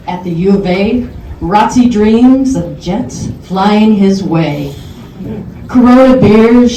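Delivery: a friend reciting his birthday poem, loud and rhythmic; poem recital. Acted. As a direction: declaim the birthday rhyme to the crowd.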